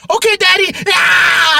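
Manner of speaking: put-on very high child's voice ending in a loud yell; daughter joining in. Daughter joining the nerd rage, high child yell. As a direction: chirp then scream like a kid. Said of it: "she was like".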